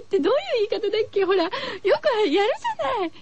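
fast, high, giggly; 笑いながら早口で; laughing. Real, not acted. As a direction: rush it out laughing. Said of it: "なぜ笑ってるか".

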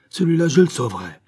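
high, loud vow, falling firmly at the end; determined exclamation. A narrator's reading. Biot vows to save the prisoner, exclaiming. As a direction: proclaim it as a resolve. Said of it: "s'écria Biot".

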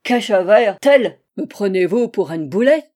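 put-on very loud, accented outrage; greatly incensed. A narrator's reading. Angel offended at talk of wings, greatly incensed. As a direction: bellow it, affronted, in mangled French. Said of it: "s'écria-t-il grandement courroucé".

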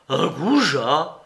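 slow, rising "goujat?" in disbelief; a mild hurt echo of the insult; outraged echo. A narrator's reading. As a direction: drag out "Un goujat?" as a shocked question. Soupe repeats the insult, outraged.

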